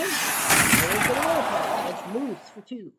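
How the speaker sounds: slow, drawn-out announcer call with a laugh; stretched penalty call. Acted. Announcer calling a penalty, stretched out and laughing. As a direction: drag it out, laughing.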